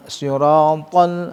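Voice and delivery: Sirotol stretched out slowly; slow, deliberate Sirotol; slow careful demo. Shown as a demonstration. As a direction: draw out Sirotol with a heavy s. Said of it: "pakai rasa".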